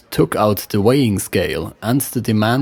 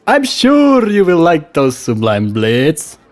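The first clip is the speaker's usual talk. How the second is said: higher, louder West Frankish trader voice; West Frankish accent; 7 semitones higher, 5 dB louder than usual. Acted. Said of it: "with a West Frankish accent".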